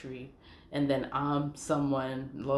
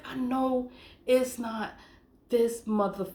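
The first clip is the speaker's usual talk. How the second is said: higher, slow, incredulous; disbelief; 8 semitones higher than usual. Retold or imitated. Realising the email is from the liar, slow high disbelief. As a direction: drag it out, not believing it.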